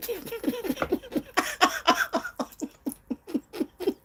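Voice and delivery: long, high, soft cackle; soft, high-pitched drawn-out laughter. Real, not acted. Eater laughing over spicy food, high laugh.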